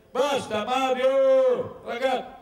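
loud, slow call to stop. Retold or imitated. A student pleading loudly with his comrades, loud slow call. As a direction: cry out to halt the crowd.

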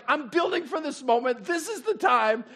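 high, loud and eager; hyped leader voice; excited. Retold or imitated. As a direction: build up to the big moment. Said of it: "he's like".

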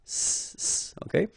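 hissed s, long and hard; the double S said as a sharp hiss; strong s. Shown as a demonstration. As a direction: push a strong s sound. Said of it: "the sound that you should produce is really strong".

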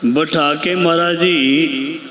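low, measured prayer voice addressing the Guru; reverent invocation. Acted. Preacher invoking the Guru before the congregation, reverent prayer voice.

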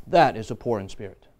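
ordinary delivery

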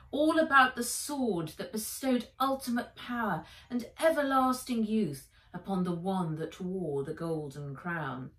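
ordinary delivery, nothing marked

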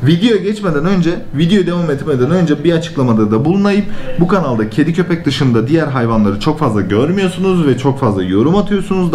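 usual speaking voice

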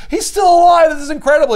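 high, loud gleeful outburst; high-pitched amazed shout; thrilled villain. Retold or imitated. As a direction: gasp it out with mad-scientist glee. Villain thrilled Wolverine survived.

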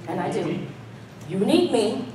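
ordinary talk, nothing marked

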